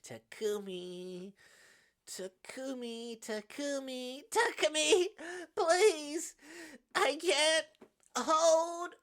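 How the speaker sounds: drawn-out name climbing to a loud, high cry; high, agonized begging as strength fails; desperate calling. Acted. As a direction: build from strained moan to scream-like plea. Calls his name, losing control.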